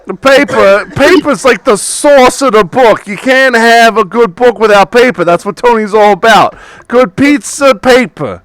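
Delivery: lightly voiced eager bookstore owner; pushy shopkeeper. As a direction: welcome the customer like a pushy shopkeeper. GM voices Tony.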